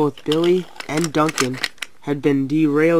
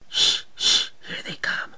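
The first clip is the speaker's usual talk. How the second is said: hushed, voiceless warning; whisper; 4 dB quieter than usual. A narrator's reading. Engines lying in wait for Billy and Duncan, whispering. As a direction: shush them, then whisper. Hiding before the ambush.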